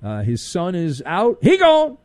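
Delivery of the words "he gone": high, loud "he gone" with a falling end; shouted dismissal of a departed staffer; mock exclamation. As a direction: crow it like a gleeful fan. Repeat of the mocking exit.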